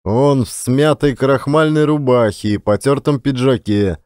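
plain reading voice

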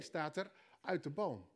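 ordinary talk, nothing marked

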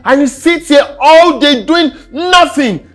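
angry shouting. Acted. Husband berating wife at home, angry shouting. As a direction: yell down at her.

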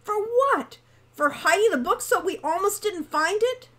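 high, loud scornful disbelief; incredulous. A narrator's reading. Hawk challenging Wren's claim to thanks, incredulous. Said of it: "said Hawk in disbelief".

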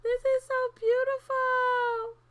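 high, gushing awe; gasp, then gush in a high voice; moved and delighted. Real, not acted. Moved by candle lighting.

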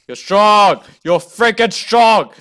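loud, high self-motivating yell; shouted pep talk. Real, not acted. Gamer psyching themself up, shouting. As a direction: shout it like a hype chant. Hyping themself up while scared.

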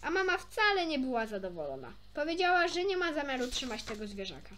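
plain reading voice

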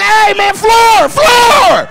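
high, full shout for the floor manager; comic alarmed yell; urgent calling out. Retold or imitated. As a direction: holler for help, hammed-up.

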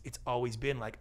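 ordinary delivery

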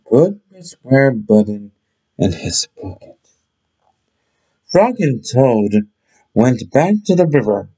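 ordinary delivery

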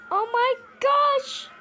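high, drawn-out cry of horror; screaming. Pretend. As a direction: cry out slowly in mock terror. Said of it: "I'm screaming".

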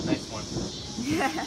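excited. Real, not acted. Reacting to thunder, loud.

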